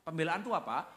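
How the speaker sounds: ordinary talk, nothing marked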